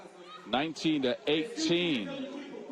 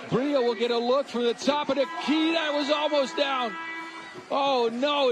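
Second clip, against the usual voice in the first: excited; 7 semitones higher than usual. Real, not acted. Game-deciding shot nearly drops.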